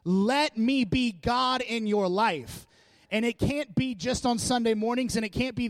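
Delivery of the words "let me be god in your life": loud, slow, pleading call as God. Acted. Preacher voicing God to a sinking congregation, loud and slow. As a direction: cry out slowly as God to the drowning.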